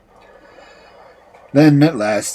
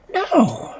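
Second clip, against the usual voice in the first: put-on girl's refusal; 11 semitones higher than usual. A narrator's reading. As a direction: refuse in a high, small voice. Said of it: "But she answered".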